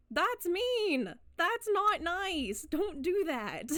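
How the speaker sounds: high, slow mock scolding. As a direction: scold like a prissy hall monitor.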